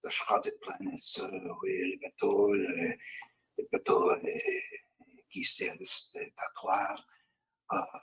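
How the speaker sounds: whispered, raving French Canadian; the prisoner mutters a prophecy in a French Canadian accent. Acted. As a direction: mutter a prophecy holding your head. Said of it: "He holds his head".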